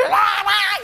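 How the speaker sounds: very high laughing shrieks; panicked shrieks. Retold or imitated. Girl shrieking at the cockroach, high laughing squeals. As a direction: squeal in mock terror. She's shouting.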